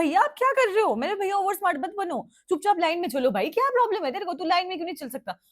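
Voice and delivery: high-pitched, exasperated telling-off of the driver; high scolding. Retold or imitated. Scolding the reckless driver, high and exasperated. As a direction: snap at him to stay in line, voice up high. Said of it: "मैंने उसको बोला".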